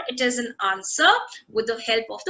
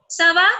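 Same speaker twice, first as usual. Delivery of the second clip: "Ça va" higher and louder, as a question; questioning intonation; 4 semitones higher, 6 dB louder than usual. Shown as a demonstration. Teacher quizzing students on question or answer, questioning intonation. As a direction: ask "Ça va" with a raised, lifted "va". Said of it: "C'est une question".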